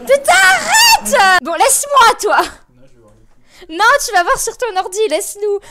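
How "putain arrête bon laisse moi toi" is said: annoyed shout. Real, not acted. Streamer shouting at the cat to stop, angry. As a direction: tell the cat off, loud.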